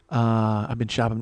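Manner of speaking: ordinary talk, nothing marked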